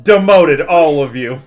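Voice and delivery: loud playful verdict; mock stern. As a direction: hand down a fake demotion. Mock punishing friends.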